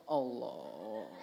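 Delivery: flexing gym-bro grunt; straining show-off. Retold or imitated. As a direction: push the word out like lifting a weight. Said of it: "Wah, pamer otot".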